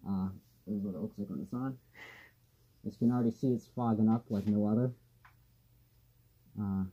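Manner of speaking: muffled behind rubber mask; speaking into mask filter; through a gas mask. Real, not acted. Seen 2: Gas mask covers mouth and nose throughout the labelled words.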